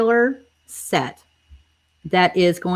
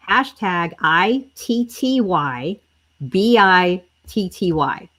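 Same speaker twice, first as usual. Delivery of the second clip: letter by letter, slow and careful, so viewers can type "I T T Y B I T T Y"; dictating "I T T Y B I T T Y" slowly for the comments; slow spelling; slower than usual. Shown as a demonstration. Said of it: "y'all have to type this right".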